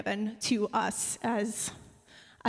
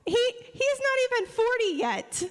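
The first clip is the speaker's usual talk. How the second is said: high-pitched playful outrage; mock indignant; 13 semitones higher than usual. Real, not acted. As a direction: protest in a squeaky indignant voice. Defending colleague's age, playful outrage.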